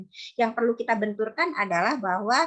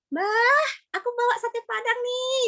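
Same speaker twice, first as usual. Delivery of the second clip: put-on high, bright child calling grandma; child announcing a gift to grandma; slower than usual. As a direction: play the kid bringing a treat, much higher. Parent voices child offering food.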